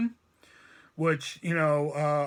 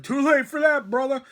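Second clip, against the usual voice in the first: higher, louder hip-hop put-down; angry street slang; 6 semitones higher, 6 dB louder than usual. Retold or imitated. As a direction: shut him down rapper-style. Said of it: "I talk like that when I get so fucking angry".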